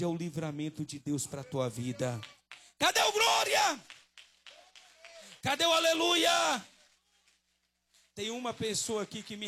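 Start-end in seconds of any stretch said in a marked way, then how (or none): 2.8-3.8 high, drawn-out yell to the crowd; rousing preacher shout. Real, not acted. Preacher demanding a glory from the church, shouting. 5.4-6.7 high, full-force call for praise; rousing preacher shout. Real, not acted. Preacher demanding a hallelujah from the church, shouting.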